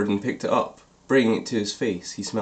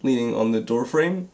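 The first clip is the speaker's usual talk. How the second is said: ordinary delivery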